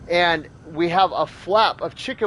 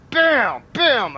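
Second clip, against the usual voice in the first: punchy, high-pitched "bam"; imitated blows; 6 semitones higher, 3 dB louder than usual. Retelling hitting it with the pellet gun, punchy imitated blows. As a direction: hit each bam like a blow.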